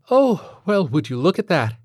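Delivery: high, exaggerated "oh" of mock surprise; feigned surprise. A narrator's reading. Teacher faking surprise to the father over the box. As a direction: pretend to be amazed.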